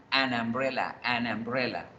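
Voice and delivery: "An umbrella" run together as anambrella; linked article. Shown as a demonstration. Teacher repeats for learners, "An umbrella" as one linked word. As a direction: glide from the n of "An" straight into "umbrella". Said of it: "la fluidez de la pronunciación".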